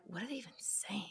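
whispered question. Retold or imitated. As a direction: breathe it with no voice. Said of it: "I was like".

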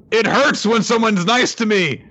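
hammed-up pained whine; anguished villain. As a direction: cry out like kindness stings. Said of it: "he's like".

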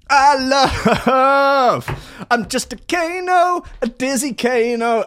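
high, loud hammed-up fanboy gush; mock fanboy. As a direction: ham up a gushing fan of Kano. Continuing mock Owen voice.

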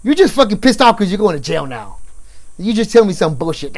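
loud, high retold outburst; hotheaded rebuke. Young officer accusing detainee of lying, hotheaded. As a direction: act out losing your temper at someone.

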